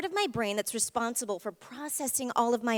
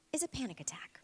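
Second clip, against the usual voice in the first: whisper; 13 dB quieter, faster than usual. Acted. Demonstrating a panic attack.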